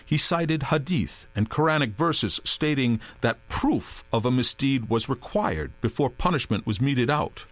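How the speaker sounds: usual speaking voice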